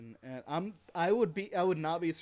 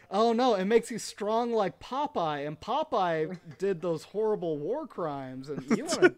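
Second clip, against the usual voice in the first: mock ad pitch; 4 semitones higher, 6 dB louder than usual. Mock voicing Popeye marketers.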